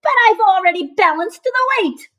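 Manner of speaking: high indignant protest. A narrator's reading. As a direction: object in disbelief. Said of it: "Muckle protested".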